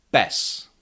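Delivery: ordinary talk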